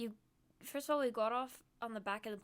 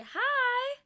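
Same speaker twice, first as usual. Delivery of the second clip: squeaky excited hi; bright high-pitched hello; excited high greeting; 14 semitones higher, 8 dB louder than usual. Real, not acted.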